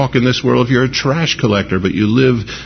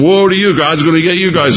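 louder, higher denouncing cry; thundering prophet; 6 semitones higher, 3 dB louder than usual. Retold or imitated. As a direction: rain down woes like a prophet.

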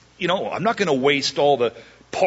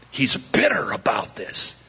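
usual speaking voice

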